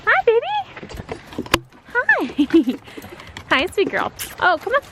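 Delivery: talking to a dog. Real, not acted. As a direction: coo at the dog in a high voice. Greeting her dogs at home.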